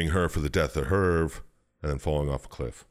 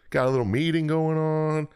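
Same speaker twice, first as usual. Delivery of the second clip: high, breezy fairy lord voice, much higher pitch; 11 semitones higher than usual. Retold or imitated. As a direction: play the smooth-talking fairy lord luring you in. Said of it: "Fairy lord's like".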